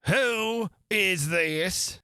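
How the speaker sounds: slow, stern royal demand; severe. Acted. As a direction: bite it out like a harsh queen. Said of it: "the queen said severely".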